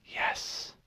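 barely breathed, voiceless; mouthed whisper. Acted. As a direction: mouth it without voice. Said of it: "silently mouthed".

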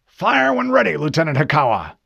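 high, shouted command easing down; loud, forceful military order; captain's order. A narrator's reading. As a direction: bellow the order across the bridge. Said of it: "Captain Keyes ordered".

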